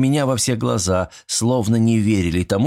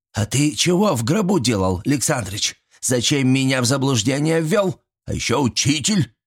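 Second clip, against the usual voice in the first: higher-pitched indignant questioning; mild indignation of an old villager; 6 semitones higher than usual. A narrator's reading. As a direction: reproach him as if you were misled. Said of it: "возмутился дядя Вася".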